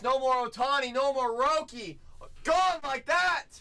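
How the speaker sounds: high, loud shout; hype shout. Real, not acted. Breaker shouting as teams sell out fast, triumphant shout. Team sold out.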